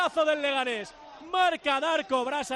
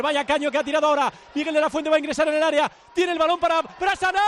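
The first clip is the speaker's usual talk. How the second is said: rising, faster, louder commentary; excited play-by-play; 3 semitones higher, 5 dB louder, faster than usual. Real, not acted. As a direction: build the tension fast. Commentator's attack builds to goal.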